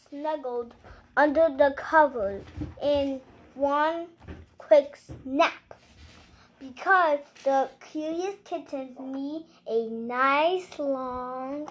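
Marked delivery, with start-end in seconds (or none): none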